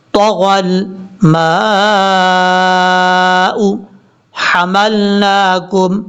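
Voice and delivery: long drawn-out tajweed chant; measured melodic recitation; slow Quran recitation. Shown as a demonstration. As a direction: recite slowly with full tajweed. Teacher recites Quran verse.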